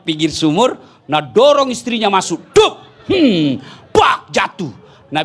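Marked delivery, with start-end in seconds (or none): 2.5-2.8 sharp high loud splash noise; splash sound effect. Retold or imitated. Preacher voicing the wife hitting the water, loud splash. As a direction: make the plop of her falling in.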